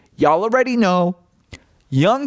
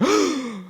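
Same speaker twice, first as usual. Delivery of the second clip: high, theatrical gasp; mock shocked gasp; 11 semitones higher, 4 dB quieter than usual. A whole room reacting to the farmer, exaggerated shocked gasp. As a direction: gasp as if taken aback. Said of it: "they're gasping like".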